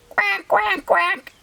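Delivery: high quacking duck; duck quacks. Acted.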